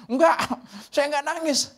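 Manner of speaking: high, loud stubborn denial; defiant retort. Retold or imitated. Old woman retorting to the preacher, defiant denial. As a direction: snap back that you won't cry.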